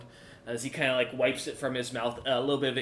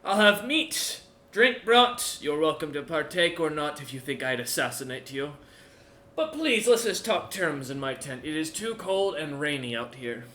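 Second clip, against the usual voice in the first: put-on boasting old warlord; 3 semitones higher, 5 dB louder than usual. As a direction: brag about the hammer with gruff pride. Odger invites them.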